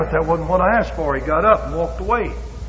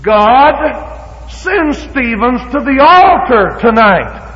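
very slow, raised, each word spaced; slow pointed prayer; 7 semitones higher, 6 dB louder, much slower than usual. Shown as a demonstration. Preacher showing the congregation a pinpointed prayer. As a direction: pray it out word by word. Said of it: "That's what we're gonna pray".

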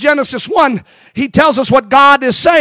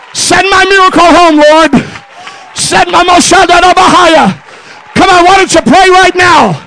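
full-force preaching; 4 semitones higher than usual. Acted. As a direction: preach it out, building to a shouted climax. Preacher builds to full-force close.

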